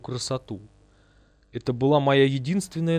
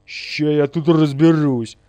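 slow, forceful, rough threat; loud threat; 3 semitones higher, 8 dB louder, slower than usual. A narrator's reading. Бурый squares up to the ghost, loud threat. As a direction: draw it out loudly, ready to fight.

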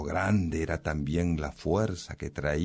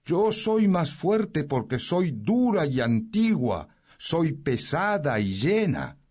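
much higher, firm boast; the stone declaring it is heavy and old; proud stone; 11 semitones higher than usual. Acted. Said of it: "dijo la piedra".

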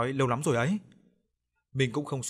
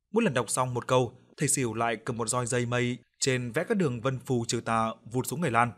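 plain reading voice

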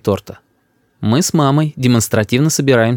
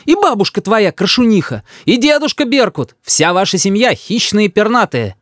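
high, indignant tirade; high-pitched heated mother; mother shouting; 9 semitones higher than usual. A narrator's reading. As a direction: insult the whole family in a huff. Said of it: "кричит мама".

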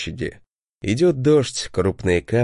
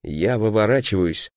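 usual speaking voice

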